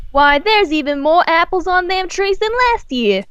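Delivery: cheerful cowgirl, voice up high; Southern farm girl voice. Acted. Applejack's lines in the story.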